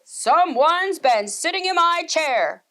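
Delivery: Papa Bear discovering his chair, loud and slow; Papa Bear exclaiming. A narrator's reading. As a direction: boom it out like a gruff papa bear. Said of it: "Papa exclaimed".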